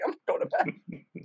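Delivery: slow, meek whisper; hushed sheepish reply to his wife. Retold or imitated. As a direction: give in softly, like a scolded kid. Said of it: "I'm like".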